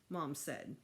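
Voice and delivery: ordinary delivery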